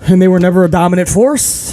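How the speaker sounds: ordinary delivery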